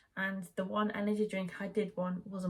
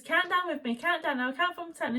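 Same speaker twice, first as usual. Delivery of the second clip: urgent coaching; 8 semitones higher, 5 dB louder than usual. Retold or imitated. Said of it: "then she says".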